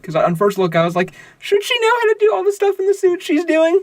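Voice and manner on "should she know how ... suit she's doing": squeaky incredulous question; way up high, doubtful; high skeptical voice. Retold or imitated. Retold own skeptical thought.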